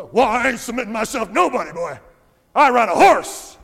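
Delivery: loud, hammed-up Southern rancher; Southern accent. Stubborn individualist refusing to submit to church rulers, mock Southern accent. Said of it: "I went into a Southern accent there".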